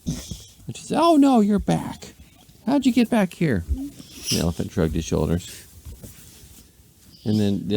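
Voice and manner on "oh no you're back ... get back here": dismayed lady. A narrator's reading. Lady finding the elephant back on her lawn, high dismayed squeak. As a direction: wail it in a tiny high pitch.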